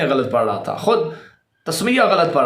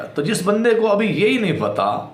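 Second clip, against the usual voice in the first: ordinary talk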